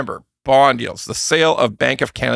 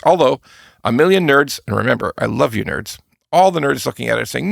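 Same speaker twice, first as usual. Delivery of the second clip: ordinary talk, nothing marked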